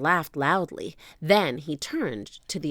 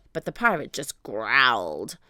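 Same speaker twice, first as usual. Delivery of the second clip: plain reading voice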